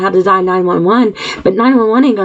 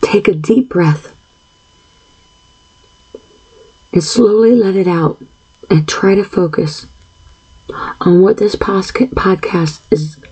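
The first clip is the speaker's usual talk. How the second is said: calming guided breathing; 2 semitones lower than usual. Real, not acted. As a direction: ease the listener into one slow breath.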